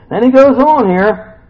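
loud announcement. Real, not acted. Announces next passage loudly.

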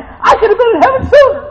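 high loud call, falling on sooner; exultant cry. Real, not acted. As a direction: shout it joyfully. Repeated heaven line.

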